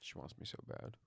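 whispered teasing boast; sly whisper. Real, not acted. Teasing joke about dog.

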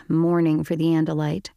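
usual speaking voice

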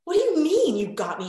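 mock outrage from the wife; wife's indignant quote. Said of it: "And she's like".

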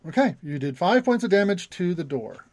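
ordinary delivery

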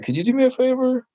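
high mimicked stranger asking a favor; imitating a woman. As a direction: pitch way up to mimic her polite ask. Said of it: "And she's like".

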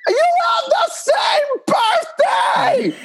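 screamed shout. Retold or imitated. Emily screaming at [name] that the mothers share a birthday, high shout. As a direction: shriek it in disbelief at him. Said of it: "and screamed it at me".

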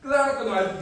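high, loud, emphatic no; high exclamation of denial. Acted. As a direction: shoot the no up high, dead certain. Preacher answers own question.

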